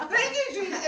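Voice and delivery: high, loud thanks to Jesus; overjoyed, calling out praise; retold happy praise; joyful thanks.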